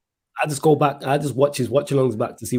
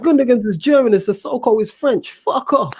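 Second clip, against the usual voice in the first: high-pitched mock rant; losing it, imitated; 10 semitones higher than usual. Retelling a streamer's rant about the players, mock outrage. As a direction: rant like an exasperated fan, pitched up. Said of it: "he lost it".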